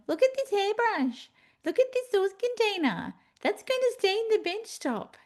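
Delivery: high, mock-taunting voice of the clutter; nagging kitchen clutter. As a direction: let the mess nag you in a high squeaky pitch. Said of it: "crap on the kitchen bench screaming at me".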